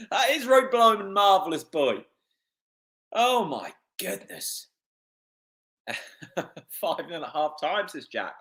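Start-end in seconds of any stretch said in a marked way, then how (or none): none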